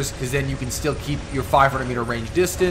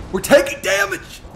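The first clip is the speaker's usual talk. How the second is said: alarmed yelp; 13 semitones higher than usual. Real, not acted. Mech under fire, alarmed.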